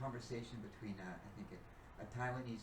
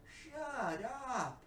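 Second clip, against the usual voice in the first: mock shut up; 10 semitones higher, 4 dB louder than usual. Woman's exclamation, retold.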